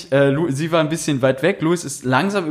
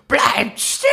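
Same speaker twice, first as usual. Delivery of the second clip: put-on high shrill yell at kids; angry shout; 12 semitones higher, slower than usual. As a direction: shout after the fleeing kids. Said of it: "die so".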